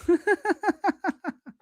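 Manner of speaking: high, rapid run of giggles; laugh. Real, not acted. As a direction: laugh in a long high ripple. Own laugh after VCR remark.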